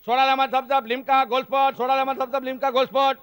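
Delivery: ઊંચા, જોરદાર અવાજે ગાતા ગાતા ફેરિયાની હાંક; સ્ટેશન પર ઠંડાં પીણાં વેચતો ફેરિયો, લયમાં હાંક મારે; ફેરિયાની બૂમ. Retold or imitated. Station hawker calling out. (ટ્રેનની બારીએ પીણાં વેચતો ફેરિયો, ઊંચી લયબદ્ધ બૂમ)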